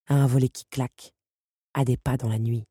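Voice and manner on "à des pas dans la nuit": ordinary delivery, nothing marked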